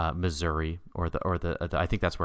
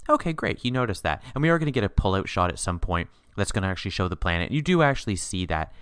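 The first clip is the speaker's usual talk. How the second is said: ordinary delivery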